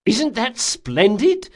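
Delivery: slow, high mock cheer; mock delight; sarcastic. A narrator's reading. Locke to the dangling bandit, sarcastic.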